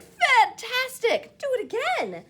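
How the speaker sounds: loud, high, slow cheer; excited shout. Acted. Elizabeth shouting to the tired dragon. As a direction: egg him on with a big cheer. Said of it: "Elizabeth shouted".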